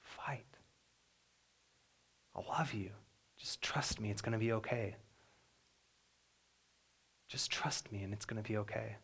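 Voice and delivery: hushed, soft reassurance, partly whispered; soft, tender comfort from father to newborn daughter. Retold or imitated. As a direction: murmur it close to a frightened baby's ear. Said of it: "And I just said".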